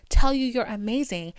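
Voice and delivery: usual speaking voice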